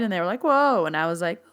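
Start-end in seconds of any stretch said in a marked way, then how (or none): 0.4-0.9 high, louder startled whoa; startled reaction. Retold or imitated. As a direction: imitate a class taken aback. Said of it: "their eyes went wide".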